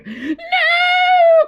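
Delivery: very high, loud terrified cry; cried no. Acted. Witch fleeing the dragon, frightened shriek. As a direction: scream no in fright. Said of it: "cried the witch".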